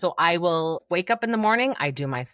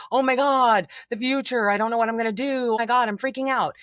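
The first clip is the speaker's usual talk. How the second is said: higher, rushed, hammed-up freaking out; mock panic; 6 semitones higher, 4 dB louder, faster than usual. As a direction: imitate someone spiralling about the future. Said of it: "I'm talking about ruminating on".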